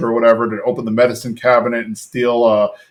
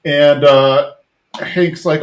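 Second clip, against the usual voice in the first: ordinary talk, nothing marked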